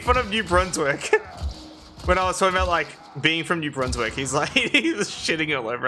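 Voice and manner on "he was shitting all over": usual speaking voice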